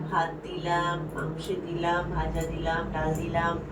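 slow, soft nursery chant; slow hushed rhyme. Pretend. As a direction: draw out the rhyme softly. Children's tickle rhyme.

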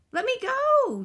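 small voice crying out, high and loud; distressed yell. A narrator's reading. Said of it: "yelled the voice".

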